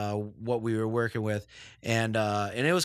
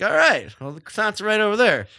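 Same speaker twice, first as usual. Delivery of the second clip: retold reply; 8 semitones higher, 5 dB louder than usual. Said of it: "And I was like".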